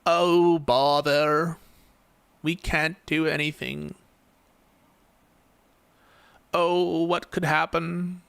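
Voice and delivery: slow, whiny mock lament of doomed Democrats; mocking a defeatist. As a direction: sneer it out like a helpless hand-wringer, dragging.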